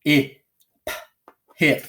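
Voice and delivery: punchy separate sounds, quick ih in "hip"; short I. Shown as a demonstration. Teacher reading a closed syllable, short ih in "hip". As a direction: sound out "H, I, p" with ih, then blend "hip". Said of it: "read it with a short I".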